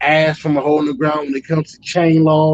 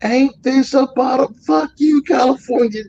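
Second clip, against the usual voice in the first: cussing out; 9 semitones higher than usual. Retold or imitated. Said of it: "I'm just like".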